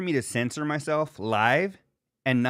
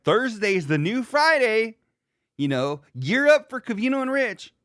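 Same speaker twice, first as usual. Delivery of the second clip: hyped promo; 10 semitones higher, 4 dB louder than usual. Acted. Reading a scheduled tweet to followers, hyped promo. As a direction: read it like an over-eager promo.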